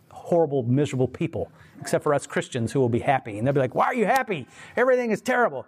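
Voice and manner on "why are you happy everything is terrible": high-pitched mock exasperation; incredulous doubters. Miserable people questioning happy Christians, high and incredulous. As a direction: whine it higher, like someone baffled by another's joy.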